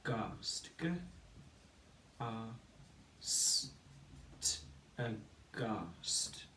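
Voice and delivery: slow, soft syllable-by-syllable blending; segmenting syllables. Shown as a demonstration. Teacher models blending aghast for pupils, slow and soft. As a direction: break it into syllables, then blend. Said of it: "We say".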